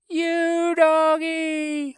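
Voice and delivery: very high, very slow, long-held wail; little girl wailing for her dog. Acted. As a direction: cry out in despair for the dog. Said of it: "Honey wailed".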